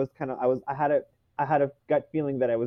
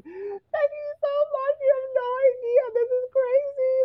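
happy sobbing. Retold or imitated. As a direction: thank them while bawling with joy. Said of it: "bawling my eyes out".

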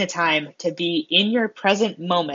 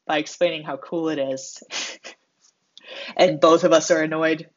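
usual speaking voice

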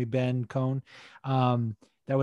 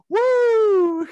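loud, very high imitated "woo"; imitated whoop; 9 dB louder than usual. Imitating co-host celebrating his pick, high whoop. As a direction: let out a piercing mock cheer. Imitates co-host's cheer at win.

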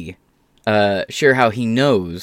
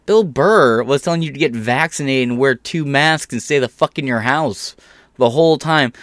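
normal talking voice

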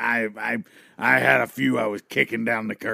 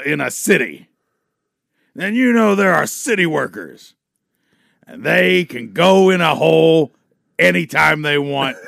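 shouted wrestler boasting, slowing on the punchline; wrestler promo; 7 dB louder than usual. Acted. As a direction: cut a loud promo, slamming the last line. Wrestler promo, city worker bit.

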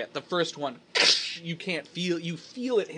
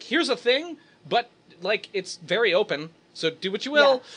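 high, loud playful rulebook voice; hammed-up voice of the rules; mock rulebook voice; 7 semitones higher, 5 dB louder than usual. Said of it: "the rules are like".

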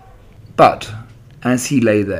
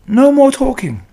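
firm; 5 dB louder than usual. Acted. Charlotte firmly ends chatter.

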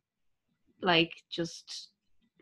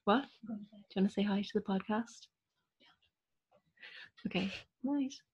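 softer, talking off-mic to mom; turning away from phone; 2 semitones higher, 8 dB quieter than usual. Real, not acted. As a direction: turn away and speak aside. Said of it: "that was my mom".

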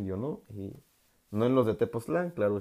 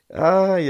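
quejido agudo; 9 semitones higher, 11 dB louder than usual. Real, not acted. Quejido tras las quemaduras.

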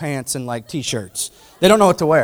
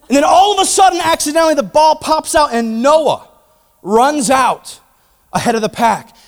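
rising, louder, building excitement; excited storytelling; 9 semitones higher, 6 dB louder than usual. Real, not acted. Preacher building up the soccer story, excited. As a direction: tell it like the big moment is coming.

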